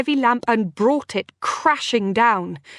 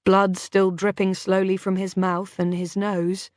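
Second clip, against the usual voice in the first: plain reading voice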